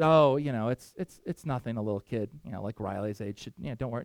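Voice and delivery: a hint of a low, soft, downcast soldier voice; dejected soldier. Dejected soldier shrugging it off to the little boy, lightly voiced.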